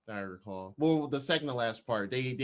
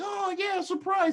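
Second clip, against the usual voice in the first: high squeaky fake surprise; mock surprise; slower than usual. Retelling the magic trick, a high chirpy surprised Geraldine. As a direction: play along with a much higher chirpy voice.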